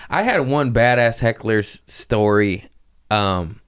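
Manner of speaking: ordinary talk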